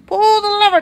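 high, loud eager push; excited urging. Real, not acted. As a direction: urge it on excitedly. Urging the player to act.

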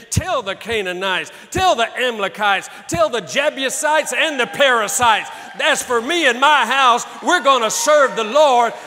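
full-force preaching. Acted. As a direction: hurl it at the devil from the pulpit.

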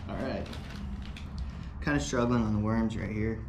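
ordinary talk, nothing marked

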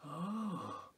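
ordinary delivery, nothing marked

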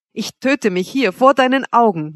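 ordinary delivery, nothing marked

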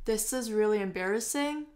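"embarrassing" dips, then rises at the end; fall-rise tune. Shown as a demonstration. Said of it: "它用的是先降后升这样子".

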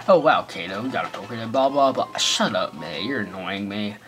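normal talking voice

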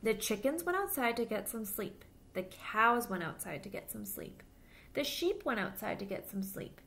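put-on lively storybook voice for little kids; reading to children. A narrator's reading. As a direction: read it aloud like storytime for children. Reads picture book to children.

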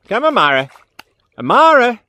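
loud high call to a dog. Real, not acted. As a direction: call her name across the water. Calling dog loudly.

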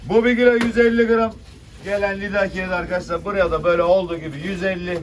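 loud auction call; auctioneer. Real, not acted. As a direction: call the lot to the room. Fish auction bidding call.

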